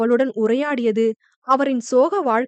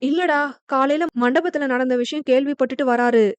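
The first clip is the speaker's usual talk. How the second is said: usual speaking voice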